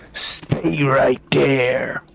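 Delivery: slow commanding demon voice. Acted. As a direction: drag out the command. Demon casts Power Word Stun.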